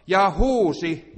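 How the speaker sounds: slow, loud and high; emphatic cry. Acted. Preacher telling how Jesus cried out, booming. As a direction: boom it out slowly.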